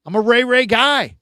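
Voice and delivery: mock-defensive, come on guys; defensive excitement. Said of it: "I'm like".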